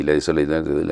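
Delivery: usual speaking voice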